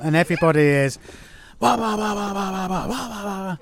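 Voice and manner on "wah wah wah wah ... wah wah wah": high-pitched babble imitating a noisy crowd; wah-wah out a din of shouting diners, without real volume; rapid run of nonsense chatter, pitched up; mock shouting chatter. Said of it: "shouting each other".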